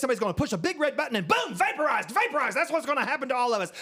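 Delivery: high, fast doomsday rant, building to loud; worked-up teacher. Acted. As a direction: get carried away preaching apocalypse to children. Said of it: "Miss Wilson would get all worked up".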